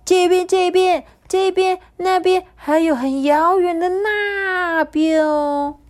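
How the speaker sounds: high, slow sing-song bird voices; chirpy little sparrows. Acted. The sparrows tell Kabu where they fly, high chirpy voices. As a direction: chatter eagerly like tiny birds.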